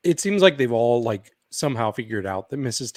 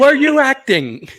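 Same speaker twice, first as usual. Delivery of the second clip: mock accusing; 12 semitones higher, 9 dB louder than usual. Voicing the accusing question.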